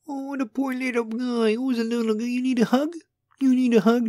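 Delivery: high cooing voice to a sad little guy; a grown man cooing over himself; mock baby-talk pity. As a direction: coo at him like a hurt toddler. Mock-pitying self as a baby.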